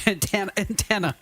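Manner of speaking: a touch of New York accent on Antenna, said twice; light New York accent, laughing it off. Shown as a demonstration. Host repeats antenna in a hint of New York accent. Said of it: "That was my New York accent".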